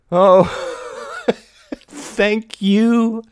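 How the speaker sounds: loud high laughing outburst; laughing cry. A narrator's reading. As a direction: burst out laughing with relief. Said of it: "cried Mary".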